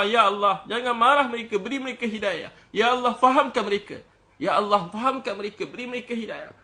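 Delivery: impassioned retold plea, high and mostly loud; doa tangisan yang ditiru. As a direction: imitate someone pleading tearfully with God. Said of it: "ada seorang tengah nangis doa". (meniru rayuan doa yang bersungguh-sungguh, bernada tinggi)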